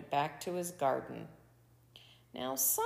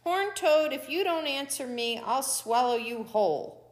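raised, higher voice, opening loud; angry coyote laying down an ultimatum; angry threat; 5 semitones higher, 4 dB louder than usual. A narrator's reading. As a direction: threaten them crossly. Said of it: "now Coyote was angry".

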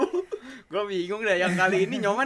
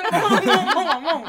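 excited urging; 11 semitones higher, 10 dB louder than usual. Real, not acted. Urging her to talk.

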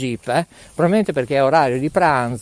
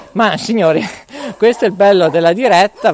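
ordinary talk, nothing marked

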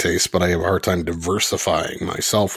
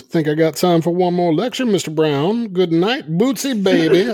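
[name] voice; 11 semitones higher than usual. Retold or imitated. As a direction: read it like a cool funk star. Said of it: "His latest tweet is".

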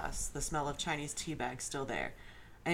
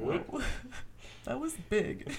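higher, giggling; laughing; 9 semitones higher, slower than usual. Real, not acted. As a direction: crack up mid-line. Reader cracking up.

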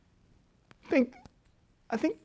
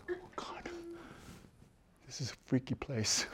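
whisper; 4 semitones lower, 19 dB quieter than usual. Retold or imitated. Retelling fear in North Korea.

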